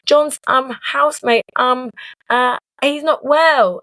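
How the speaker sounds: high, hesitant nervous stammering; lying to a boss on the phone, flustered and stammering. Retold or imitated. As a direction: panic on the phone, stumbling over every word. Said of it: "And I was like".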